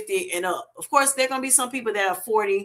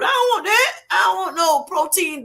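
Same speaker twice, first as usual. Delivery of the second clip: a hint of a whiny relative, pitched higher; family member pushing back on the diet food, lightly voiced and higher; voicing a family member; 8 semitones higher than usual. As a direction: play a family member refusing the protein, voice up high.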